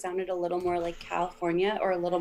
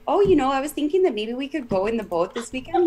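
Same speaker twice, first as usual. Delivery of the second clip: thick Minnesotan accent, pitched up and lilting; 5 semitones higher than usual. Shown as a demonstration. Chatting with family about the boat, thick Minnesotan accent. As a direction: let a broad Minnesotan accent take over. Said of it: "it does sneak in sometimes".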